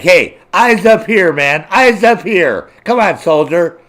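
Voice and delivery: loud, high, slow telling-off; mock scolding. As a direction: bark it like a drill sergeant at a soldier. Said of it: "I'd be like".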